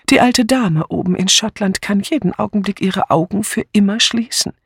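put-on elderly aunt's high, worried voice; high-pitched old lady fretting to herself; Miss Marple, troubled. Miss Marple explains the urgency. (besorgte alte Tante mit hoher Stimme)

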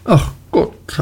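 hoch gerufenes „Ach Gott“ mit fallendem Ton; kindisches Jammern. A narrator's reading. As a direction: seufzend hoch klagen. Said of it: "rief sie in ihrer kindischen Art". (hohes, jammerndes „Ach Gott“)

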